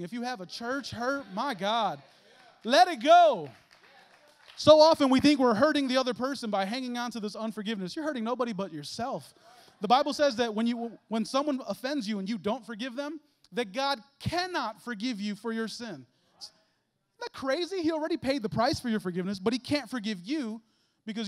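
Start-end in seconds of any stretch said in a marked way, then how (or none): 0.0-3.5 rising to a loud, high cry of let it go; fervent preaching. Real, not acted. 4.6-19.4 loud, driving sermon voice, slowing on the point; preaching at full force. Real, not acted.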